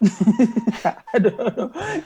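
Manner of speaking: drawn-out laugh, high and loud; real laughter, brushing off the compliment. Real, not acted. Teased that women like him, laughing it off.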